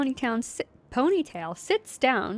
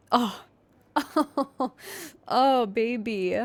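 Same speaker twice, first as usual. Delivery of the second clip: put-on baby talk; 2 semitones higher, much slower than usual. Cooing at the cat.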